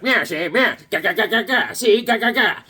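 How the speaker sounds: lightly voiced gangster spraying bullets; mock old movie gangster; comic gun sounds; gangster with tommy gun. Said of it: "He's like".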